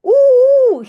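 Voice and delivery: long high loud squeal; a girl's shocked squeal; shriek. A narrator's reading. Janneke seeing the dog eat the pancake, loud high shriek. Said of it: "gilt Janneke".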